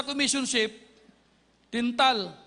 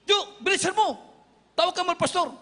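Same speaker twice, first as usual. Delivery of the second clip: calling out in a raised, urgent voice; retold yelling; 6 semitones higher than usual. A companion yells to the pastor to hurry, urgent raised call. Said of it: "pasigaw na si John Lusa".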